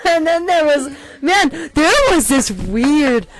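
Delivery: high, loud, excited storytelling; real excited outburst; got excited. Real, not acted. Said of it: "I got excited".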